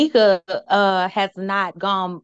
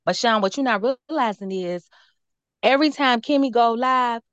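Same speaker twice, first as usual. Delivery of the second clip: ordinary delivery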